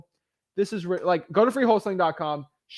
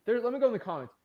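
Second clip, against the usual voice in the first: usual speaking voice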